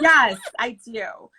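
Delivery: drawn-out, loud mock acceptance; emphatic yes. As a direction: play up the slow, emphatic agreement. Repeats her acted eager answer.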